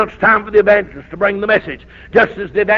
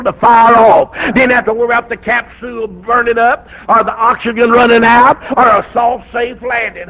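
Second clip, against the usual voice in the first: preaching at full force; 2 semitones higher, 5 dB louder than usual. Acted. Preaching climax.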